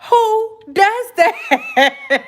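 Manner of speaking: high, slow, laughing incredulity; cracking up in disbelief. Real, not acted.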